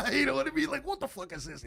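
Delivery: loud, high laughing disbelief; laughing at selling weed to a rock star, loud and high. Real, not acted. Disbelieving laugh after the story.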